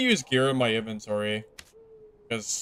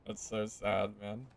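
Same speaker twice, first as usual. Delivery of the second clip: quiet mock lament; 4 semitones lower, 13 dB quieter than usual.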